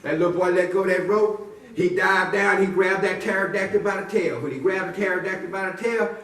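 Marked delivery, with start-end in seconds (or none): none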